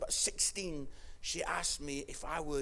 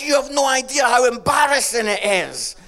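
high, very loud protest; embarrassed daughter; 9 semitones higher, 17 dB louder than usual. Retold or imitated. Daughter complaining about dad at her race, embarrassed. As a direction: cry out in mock mortification. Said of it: "She goes".

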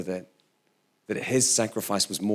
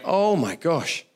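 slow amazed gasp; 5 semitones higher, much slower than usual. Retold or imitated. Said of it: "And I went".

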